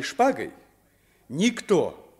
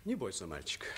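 gentle reassurance; 8 semitones lower, 13 dB quieter, much faster than usual. Acted. Утешает испуганного мальчика.

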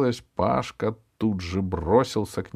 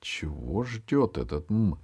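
ordinary delivery

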